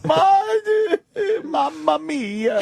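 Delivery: hammed-up Italian sobbing, very high; mock sobbing. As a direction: sob mamma mia like a heartbroken chef.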